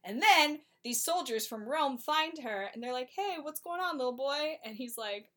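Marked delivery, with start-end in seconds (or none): 3.1-4.6 high, sing-song questioning; mock friendly soldier. Roman soldiers asking the boy what happened. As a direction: talk down sweetly to a lost boy.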